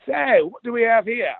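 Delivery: put-on a friendly Australian boss's greeting in an Australian accent; Rupert Murdoch's Australian accent. The boss greets the booth demo guy, imitated Australian accent. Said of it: "in his nice Australian accent".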